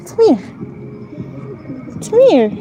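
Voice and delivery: very high little summons, falling at the end; calling a dog. Real, not acted. Walker to a wary dog, high coaxing call.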